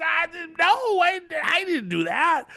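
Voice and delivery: very high, hammed-up panicked denial; mock screaming. Brienne accused of killing Renly, mock-screamed panic. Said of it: "Brienne's screaming".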